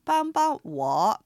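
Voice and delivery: 帮帮我 said very slowly, each syllable clear, 帮 level then light, 我 dipping; slow Chinese demo. Shown as a demonstration. Teacher modelling 帮帮我 for Japanese listeners, slow and clear. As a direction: spell out 帮帮我 slowly for learners. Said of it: "おさらいしましょう".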